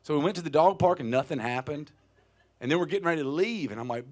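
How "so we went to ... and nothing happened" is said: ordinary talk, nothing marked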